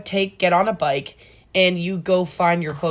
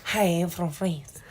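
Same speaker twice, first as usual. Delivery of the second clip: soft, slow, badly faked French accent; attempted French accent; 9 dB quieter, slower than usual. Shown as a demonstration. Unsure how to imitate French, fake French accent. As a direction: try a hesitant French accent, slowly. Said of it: "how to imitate French".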